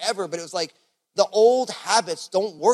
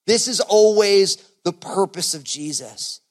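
fervent preaching; 5 semitones higher, 7 dB louder than usual. Acted. Preaching at full force.

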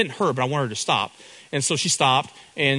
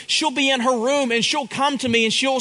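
ordinary talk

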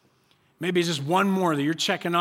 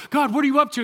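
higher, louder cry of frustration; exasperated plea; 9 semitones higher, 6 dB louder, faster than usual. Acted. Congregation crying out to God in frustration. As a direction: throw the question up at heaven.

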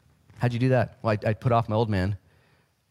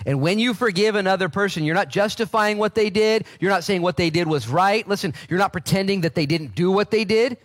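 higher, louder emphatic preaching; insistent sermon voice; preaching at full force; 9 semitones higher, 4 dB louder than usual. Acted. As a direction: drive the point home to the congregation.

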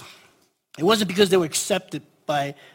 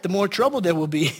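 ordinary talk, nothing marked

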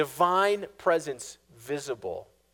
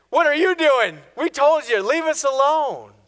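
much higher and louder accusing cry; yelling complaint; 11 semitones higher, 10 dB louder than usual. Acted. Israelites yelling at Moses by the sea, loud accusing cry. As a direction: shout it at Moses in frustration.

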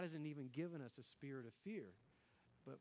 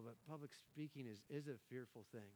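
ordinary talk, nothing marked

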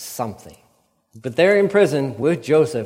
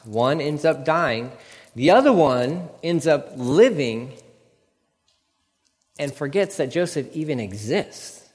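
ordinary talk, nothing marked

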